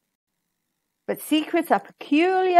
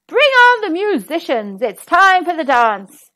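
royal command; 4 semitones higher, 6 dB louder than usual. Acted. King calls for musicians.

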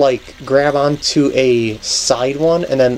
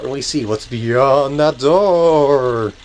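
eerie ghost-story tone, lightly done; a hint of a haunted-house voice; spooky voice; much slower than usual. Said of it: "That was a bad, spooky voice".

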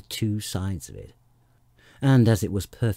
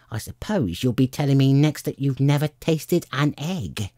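raised, emphatic bird rant; accusing bird, pitched up; scornful pigeon; 5 semitones higher than usual. A narrator's reading. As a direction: argue down the child with disdain.

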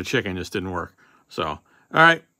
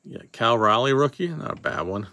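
usual speaking voice

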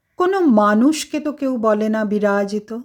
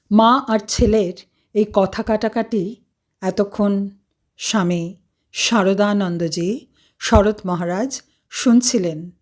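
plain reading voice

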